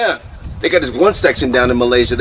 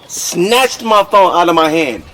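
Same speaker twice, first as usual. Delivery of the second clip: ordinary talk, nothing marked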